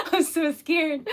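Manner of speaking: giggling while saying I'm so scared; laughing through the words. Real, not acted. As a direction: laugh it out, mock frightened.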